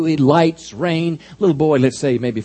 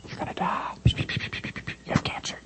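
fearful inner whisper; 11 dB quieter than usual. Acted. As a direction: let fear whisper the worst. Fear's inner voice after the call.

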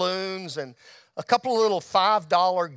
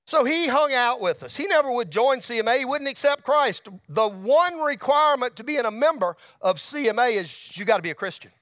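normal talking voice

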